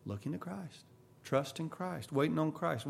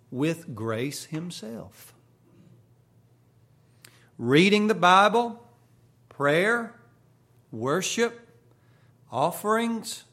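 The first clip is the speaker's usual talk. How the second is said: slow, raised preacher's reading, item by item; emphatic quotation reading; 3 semitones higher, 5 dB louder, slower than usual. Acted. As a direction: read it out to the congregation, spacing each phrase. Reads a quotation to congregation.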